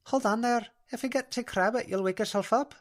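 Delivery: high, lilting Scottish brogue; a gentle Scots spirit coaxing the girl; Scottish dream spirit. Acted. Alpina's Scottish voice.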